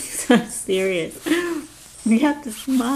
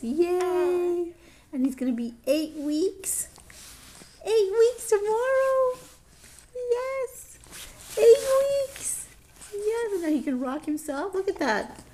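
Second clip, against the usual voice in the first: high excited coo; 9 semitones higher, 3 dB quieter than usual. Real, not acted. Mother praising her baby's milestones, high cooing.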